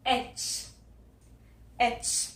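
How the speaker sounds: "एच" said whispered as aitch; letter H. Shown as a demonstration. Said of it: "ये है".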